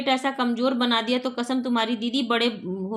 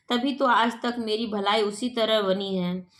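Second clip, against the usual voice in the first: ordinary talk, nothing marked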